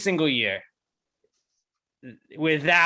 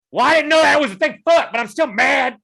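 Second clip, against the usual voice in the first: higher, louder, fast hammed-up indignation; mock angry tweeter; 9 semitones higher, 7 dB louder than usual. Mocking a Twitter user who stays mad, higher and louder. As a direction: play the sulky outraged user, over the top.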